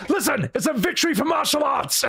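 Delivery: high, hammed-up shout of victory. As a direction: insist at full volume. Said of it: "he's like".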